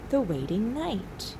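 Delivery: plain reading voice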